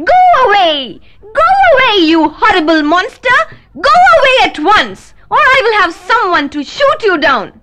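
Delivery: high, loud panicked shriek; shrieking nurse. A narrator's reading. Nurse shrieks at the wolf at her window. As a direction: scream him away from the window. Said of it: "she shrieked".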